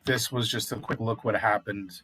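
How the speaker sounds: normal talking voice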